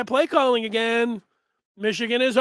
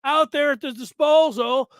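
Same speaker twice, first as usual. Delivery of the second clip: higher, fast mock fan rant; 1 fan complaining; 7 semitones higher, 4 dB louder than usual. Fan griping to the host that Michigan is too predictable, high mock rant. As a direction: whine like a fed-up fan about wasted talent. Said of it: "you're gonna say".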